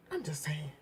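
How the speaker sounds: whispered, dropped to nothing; whispered aside. Real, not acted. Vlogger ending her rant, whispered aside. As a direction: whisper it as a throwaway.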